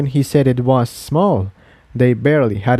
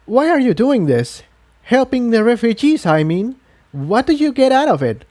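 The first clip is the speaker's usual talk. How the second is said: put-on much higher, earnest young mare; Incendia's voice; 10 semitones higher than usual. A narrator's reading. Refugee asking the host why he helps them, high and earnest. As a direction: press him with a suspicious question.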